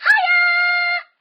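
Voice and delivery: high, loud cheery shark shout; big beaming cartoon shark yell; friendly shark greeting.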